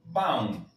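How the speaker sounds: Baum as a nasal ending; nasal Portuguese Baum. Shown as a demonstration. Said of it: "Sabemos que se va a pronunciar".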